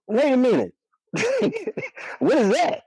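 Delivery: high, laughing double take; laughing surprise. Retold or imitated. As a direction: catch yourself mid-laugh, stunned. Retold shock seeing pregnant belly.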